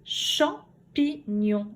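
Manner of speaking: slow "Champignon", cham, pi, gnon; syllable by syllable. Shown as a demonstration. Teacher repeating the word for pupils, "Champignon" split slowly. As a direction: leave gaps between the three syllables of "Champignon". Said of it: "Dessine les syllabes".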